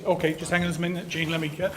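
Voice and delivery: usual speaking voice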